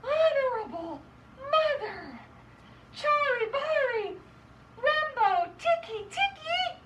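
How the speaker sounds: high, slow, broken gasps; acted out-of-breath child, very high; gasping. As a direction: gasp out scrambled words high and loud. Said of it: "he gasped".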